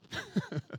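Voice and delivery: quick, high, soft chuckle; brief amused chuckle; short laugh. Real, not acted. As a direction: chuckle lightly to yourself. Laughs at own picture.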